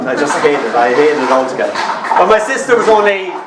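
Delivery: normal talking voice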